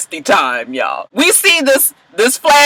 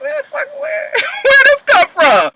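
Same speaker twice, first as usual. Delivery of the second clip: much higher, slow, laughing disbelief; stunned. Retold or imitated. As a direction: squeal it in amazed disbelief. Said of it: "you be looking at him like".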